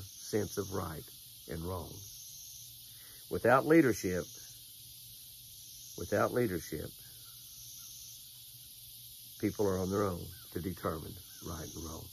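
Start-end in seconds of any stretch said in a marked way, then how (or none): 6.0-12.0 softer and lower, let it sink in; from a firm repeat down to a low, soft hush; fading solemn repeat. Acted. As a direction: drop the voice as you repeat the warning. Preacher repeats key line, fading.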